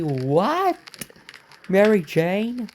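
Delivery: high, rising "Mary Jane?" in shock. Real, not acted. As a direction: gasp it out in disbelief. Shock at seeing wife.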